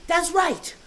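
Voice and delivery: put-on high, loud, gleeful little girl; emphatic egging on. Sister eggs mother on, high and emphatic. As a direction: punch every sentence end. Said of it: "put the punctuation at the end of every sentence".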